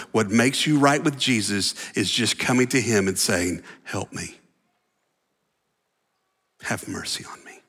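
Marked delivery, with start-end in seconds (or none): 3.8-4.4 much softer, hushed plea; a quiet plea for help; humble plea. Shown as a demonstration. Preacher voicing humble plea. 6.6-7.6 softer, breathy whisper; a whispered Have mercy on me; whispered plea. Shown as a demonstration. Preacher voicing humble plea.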